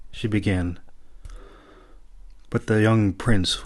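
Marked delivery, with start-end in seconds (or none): none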